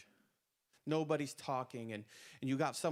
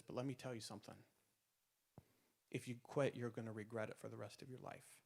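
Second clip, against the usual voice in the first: hushed warning; 3 semitones lower, 8 dB quieter, faster than usual. Retold or imitated. Instructor to trainees, softer and grave, a confiding warning. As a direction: drop to a soft, grave warning.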